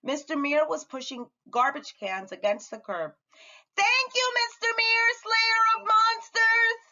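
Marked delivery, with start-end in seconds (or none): 3.8-6.8 put-on cheerful child voice. Child calling greeting to neighbour.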